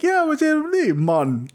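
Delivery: hammed-up fake Jamaican lilt; bad fake Jamaican accent. Podcaster mocks actress's fake Jamaican accent. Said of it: "that Jamaican accent was so bad".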